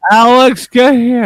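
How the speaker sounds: loud call to someone in another room; yelling across the room. Pretend. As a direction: shout the name to summon him. Calling someone over, then kidding.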